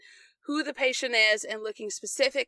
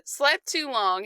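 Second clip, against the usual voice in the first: normal talking voice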